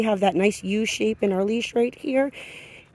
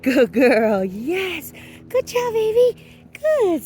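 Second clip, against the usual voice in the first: high gushing praise to a dog; shower the dog with praise, much higher; 11 semitones higher, slower than usual. Real, not acted. Trainer praising her dog in high baby talk. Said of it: "I'm also using a lot of verbal praise with her".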